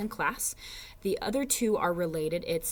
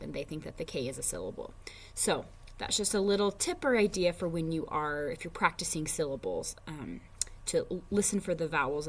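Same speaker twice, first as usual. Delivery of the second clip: usual speaking voice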